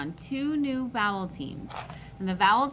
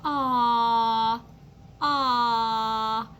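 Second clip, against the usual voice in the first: cooing aw; 2 semitones higher, 3 dB louder than usual. Shown as a demonstration. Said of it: "heard me go".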